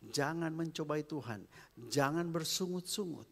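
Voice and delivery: normal talking voice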